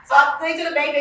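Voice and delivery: normal talking voice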